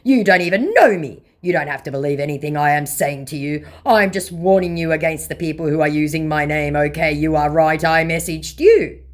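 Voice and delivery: hammed-up protest from an impostor; mock indignant scammer. Vlogger reading out the scammer's defence, in mock indignation. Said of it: "said the scammer".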